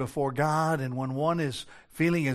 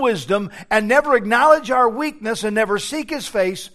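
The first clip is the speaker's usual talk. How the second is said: loud, rising preacher's build; preaching at full force; 7 semitones higher, 7 dB louder than usual. Real, not acted. Pastor pressing the church, preaching at full force. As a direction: drive the point home to the congregation.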